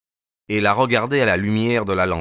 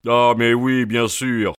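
usual speaking voice

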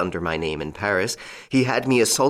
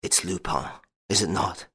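despairing; 5 dB quieter than usual. Acted. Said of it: "said to him fiercely, despairingly".

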